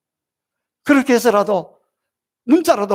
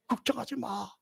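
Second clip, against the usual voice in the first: tender hush; 15 dB quieter than usual. Acted. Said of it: "내 입술을 만지면서".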